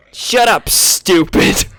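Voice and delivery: high mock yell of a pig-man; gamorrean insult. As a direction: hurl it like a schoolyard taunt.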